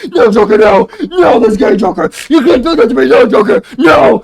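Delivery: hammed-up loud anguished shout; Batman screaming. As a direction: scream in mock horror at the Joker.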